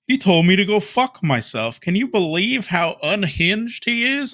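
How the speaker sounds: high-pitched mocking put-on accent; exaggerated high-voiced accent of an indignant complainer; D S P impression. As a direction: whine it in a high, silly accent. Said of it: "that's my D S P accent".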